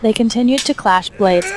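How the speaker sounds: plain reading voice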